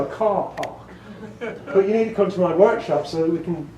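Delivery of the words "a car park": British accent. Shown as a demonstration. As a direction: correct them in British English, stretching "car park" without r sounds. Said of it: "where do you park your car?".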